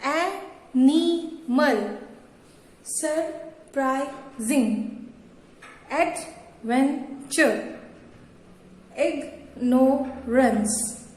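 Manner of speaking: very slow, three separated beats per word; syllable by syllable. Shown as a demonstration. Teacher showing three-syllable words, halting after each syllable. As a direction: break "wonderful", "animal", "surprising", "adventure", "ignorance" into three with pauses. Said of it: "तीन बार उसको हम रुक रुक कर पढ़ते हैं".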